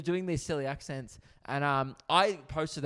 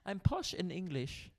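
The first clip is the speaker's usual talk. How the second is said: slow, higher mock-posh English; posh English accent; 4 semitones higher than usual. Shown as a demonstration. Host trying proper English on request, in a badly faked posh accent. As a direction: attempt a well-to-do English accent. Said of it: "like a well-to-do posh".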